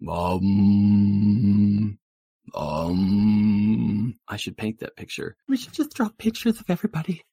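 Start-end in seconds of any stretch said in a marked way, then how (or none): 0.1-4.1 long, steady droning "om"; loud meditative drone; soothing frequency hum. Retold or imitated. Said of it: "like, soothing frequency". 5.5-7.2 put-on very high, quick girly gush; high-pitched sugary enthusiasm; gushing valley girl, sweet.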